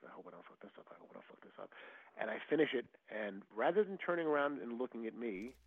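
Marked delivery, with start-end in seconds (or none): 0.1-0.8 much softer nervous muttering; anxious self-talk, barely audible; under breath. Retold or imitated. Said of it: "I'm just like". 0.9-1.7 nervous whisper, much lower and softer; anxious whispered self-talk. Retold or imitated. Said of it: "I'm just like".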